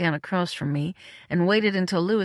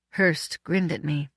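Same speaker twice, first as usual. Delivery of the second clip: ordinary delivery, nothing marked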